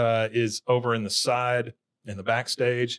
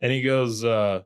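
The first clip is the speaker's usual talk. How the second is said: usual speaking voice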